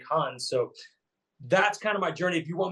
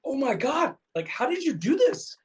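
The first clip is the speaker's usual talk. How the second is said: imitating amazed fans, pitched much higher; amazed reaction; 10 semitones higher than usual. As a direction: gush like a stunned fan seeing the photos. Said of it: "and people were like".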